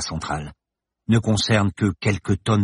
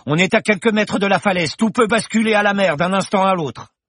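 much higher, louder warning; shout; 13 semitones higher, 4 dB louder than usual. Acted. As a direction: yell the danger. Bergton urging on cliff edge. (cri d'alerte)

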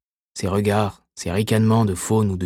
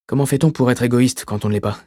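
ordinary delivery, nothing marked

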